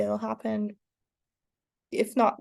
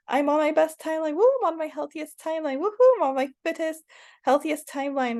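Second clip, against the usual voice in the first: higher, bright hyped chant of affirmations; cheerful self-hype, moderately louder; 6 semitones higher, 3 dB louder than usual. Shown as a demonstration. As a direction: pump yourself up as you recite. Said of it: "it hypes you up".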